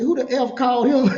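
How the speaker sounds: loud and laughing, voice climbing; laughing disbelief. Retold or imitated. Waking after surgery, laughing at the chaplain. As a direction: blurt it out through laughter.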